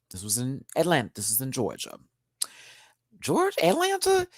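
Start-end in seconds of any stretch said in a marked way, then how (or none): 3.2-4.3 much higher, puzzled double-check; unsure questioning. Real, not acted. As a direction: ask yourself, suddenly unsure. Second-guessing the city.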